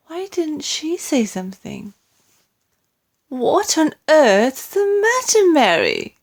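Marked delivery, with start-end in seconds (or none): 3.3-6.2 concerned queen. A narrator's reading. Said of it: "the famous voice was saying".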